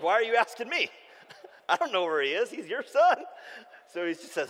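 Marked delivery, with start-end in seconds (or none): 0.0-3.2 put-on higher, louder, laughing sassy toddler; a three-year-old shrugging it off with a laugh; mock toddler. Son answering his dad, sassy and laughing.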